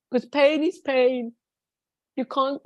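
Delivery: ordinary talk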